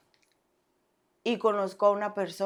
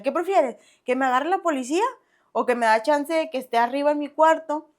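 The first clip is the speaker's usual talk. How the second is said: cheeky challenge, pitched higher; defiant; 6 semitones higher, 5 dB louder than usual. Retold or imitated. Defying her mother, mock cheeky and higher. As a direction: taunt her like a brazen teen.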